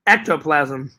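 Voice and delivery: "Ectoplasm" said slowly and deliberately; careful pronunciation. Shown as a demonstration. Viewer asked for the name, careful pronunciation. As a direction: try "Ectoplasm" as a correction. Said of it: "I don't know how to say it".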